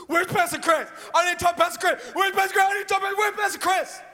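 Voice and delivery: high, urgent, rising demand for Pastor Chris; high insistent woman's voice repeating the name; frantic woman. Retold or imitated. Frantic woman storming the office.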